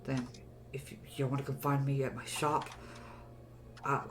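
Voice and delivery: soft, shaky whispered thanks; grateful hushed voice. Acted. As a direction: whisper thanks, still frightened. Freed prisoner thanks party.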